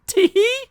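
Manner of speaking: put-on very high girlish tee-hee giggle; a coy little high giggle; added giggle. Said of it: "so I added the tee-hee".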